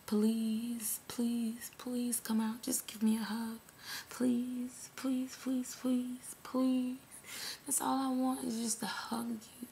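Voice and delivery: mock-pitiful plea, half whispered; imitated begging. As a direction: beg in a small hushed voice, repeating each please. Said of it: "crying and begging for [name]".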